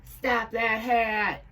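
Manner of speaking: high, loud, slow angry shout; furious pilot yelling after the hat. A narrator's reading. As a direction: bellow at the runaway hat. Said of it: "yells the furious pilot".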